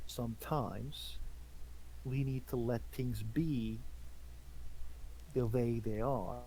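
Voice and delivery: slow, solemn leader voice; grave knight. Acted. Gaius speaks gravely to Torden.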